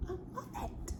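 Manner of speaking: breathy unvoiced whisper of pleasure; hushed voiceless savoring; whispered delight. Real, not acted. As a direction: breathe it out like a private treat. Tasting the chocolate.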